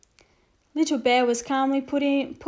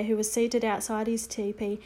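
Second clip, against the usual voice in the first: usual speaking voice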